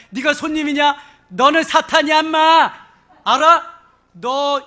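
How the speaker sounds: loud, high, accusing shouts; re-enacted rebuke hurled at the visitor; furious denunciation. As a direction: denounce them at full voice. Denounces the visitor as Satan.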